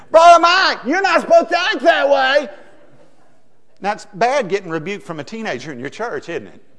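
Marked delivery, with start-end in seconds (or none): 0.1-2.5 put-on a high, loud, scolding teen call; teenager's rebuke. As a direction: call out across the street to correct him.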